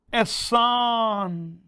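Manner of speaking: एहसान drawn out slowly, each syllable held; mnemonic pronunciation. Shown as a demonstration. Teacher correcting how students say the mnemonic word, slow and stretched. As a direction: say एहसान long and slow like a chant. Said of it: "मैं एहसान नहीं बोल रहा हूँ".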